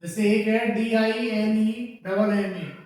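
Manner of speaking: ordinary talk, nothing marked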